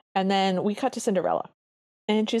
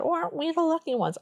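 put-on higher-pitched, half-hearted Brooklyn accent; attempted Brooklyn accent; 9 semitones higher, faster than usual. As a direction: have a weak go at a Brooklyn accent. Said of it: "talking in their Brooklyn accents".

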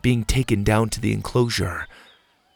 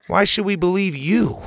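put-on higher, defiant boy; angry challenge; 9 semitones higher than usual. Bo defiantly challenges Pa before everyone. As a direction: throw the question at Pa.

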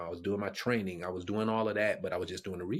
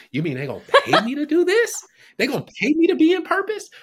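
much higher and louder, laughing it out; laughing disbelief; 13 dB louder than usual. Retold or imitated. Speaker amazed he'd be paid for purpose, laughing high. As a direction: squeal with happy disbelief.

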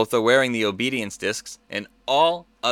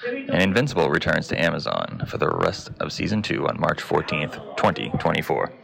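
low, even newsreader voice; news read; 6 semitones lower than usual. Acted. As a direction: read the headlines like a news anchor.

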